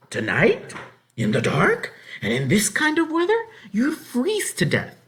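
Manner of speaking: high, loud disbelieving scold; scolding farm wife. A narrator's reading. Farmer's wife scolds the child for travelling at night, appalled.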